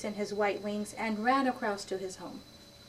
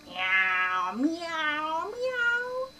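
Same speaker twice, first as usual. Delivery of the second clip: rising meows, climbing higher each time; cat meowing; 6 semitones higher, much slower than usual. Acted. Cat suitor meowing to Martina, rising playfully. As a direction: meow like a courting cat. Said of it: "I will speak to you like this".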